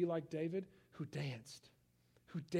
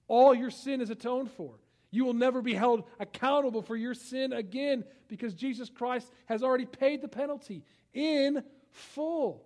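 much higher and louder, slowing on the last words; fervent preaching; 8 semitones higher, 12 dB louder than usual. Acted. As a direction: proclaim it to the whole hall. Preaching at full force.

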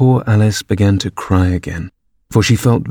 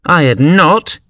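high, sharp retort; sharp and angry; 8 semitones higher, 3 dB louder, slower than usual. A narrator's reading. Mouse contradicts Alice, sharply and angrily. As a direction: cry it out crossly. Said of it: "cried the mouse sharply and very angrily".

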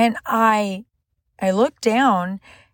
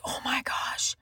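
hushed, breath-only gasp of awe; retold tearful awe, barely voiced; overwhelmed whisper; 3 semitones higher, 12 dB quieter, slower than usual. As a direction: whisper it as if overcome. Retold overwhelmed reaction while crying.

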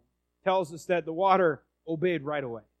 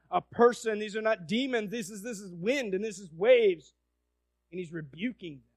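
ordinary delivery

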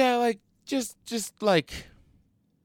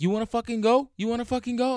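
high-pitched mock challenge, louder; imitated angry yelling; 11 semitones higher, 5 dB louder, faster than usual. Brake-checking driver challenging the man tailing him, mock angry yell. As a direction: act out a driver picking a fight. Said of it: "yelling at me".

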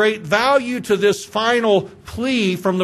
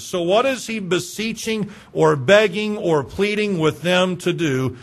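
ordinary delivery

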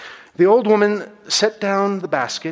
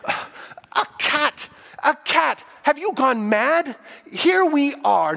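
angry; 7 semitones higher than usual. Acted. Starving artist scolds housekeeper.

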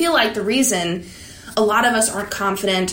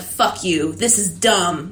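mad at affirmations; 3 semitones lower, slower than usual. Retold or imitated. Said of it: "That's me when I'm mad".

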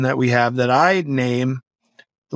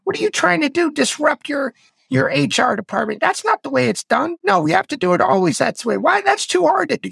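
much higher, fast whining voice; mock whiner voice putting you down; whiny inner critic. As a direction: nag like a sneering inner whiner. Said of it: "We know how it talks to us".

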